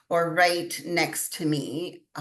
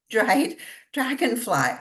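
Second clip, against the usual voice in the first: laughing through "dried dragonfly", pitched up; 6 semitones higher, 4 dB louder than usual. Real, not acted. Showing the listener her find, laughing. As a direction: chuckle as you name it.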